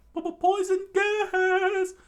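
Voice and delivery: high, frantic scream, slowed; high-pitched panicked scream. Acted. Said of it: "Gin screamed out".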